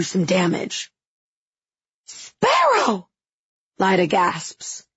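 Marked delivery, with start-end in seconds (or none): 2.1-3.1 sharp, high alarmed cry of a name; high harsh cry. Acted. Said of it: "Her voice is high and harsh".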